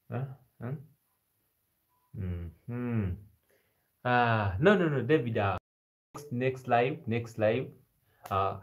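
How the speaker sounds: usual speaking voice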